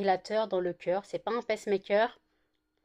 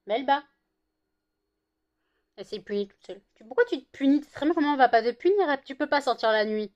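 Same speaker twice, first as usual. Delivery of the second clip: talking to dog; 4 semitones higher, 6 dB louder than usual. Real, not acted. Calling the dog.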